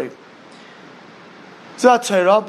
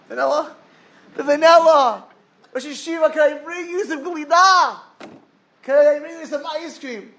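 much higher and louder, gushing over sweets; excited boy; 8 semitones higher, 3 dB louder than usual. Acted. Yeshiva boy tempting the sage with ice cream, high and gushing. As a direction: tempt him like a kid in a candy shop.

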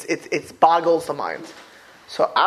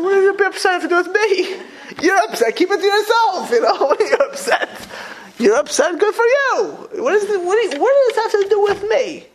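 laughing mock scolding. Rabbi retells scolding his upset son.

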